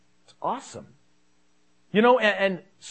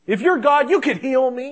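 higher, louder acted protest to God; acted frustrated demand, pitched up; frustrated, upset complaint; 6 semitones higher, 6 dB louder than usual. Imagined sufferer complaining to God, frustrated and upset. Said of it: "frustrated with God and upset".

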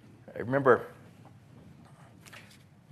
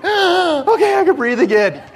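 high, loud gasping relief; mock relief; 13 dB louder than usual. Preacher bursts out of the car, finally breathing. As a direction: gulp the air and sigh it out. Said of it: "I'm like".

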